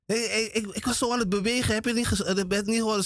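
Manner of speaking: agitated husband. Retold or imitated. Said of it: "retold husband waking wife".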